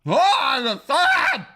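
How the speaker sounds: high, loud yelp; loud outburst. Real, not acted. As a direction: blurt it out loud. Outburst while eating pizza.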